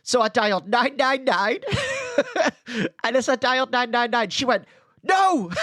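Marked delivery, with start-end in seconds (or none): 1.6-3.0 high burst of real laughter. Real, not acted. Laughing at own story. 5.0-5.5 mock panicked no. Teacher stops the emergency call.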